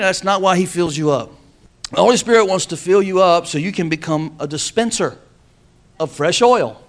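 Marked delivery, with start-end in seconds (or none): none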